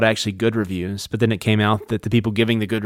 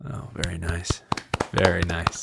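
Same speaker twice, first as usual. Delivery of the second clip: low, soft mock French approval; French accent; 5 semitones lower, 7 dB quieter than usual. Co-host as Frenchman admiring the shout-out, soft French accent. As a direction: murmur approval in put-on French. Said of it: "This Frenchman speaks wonderful English".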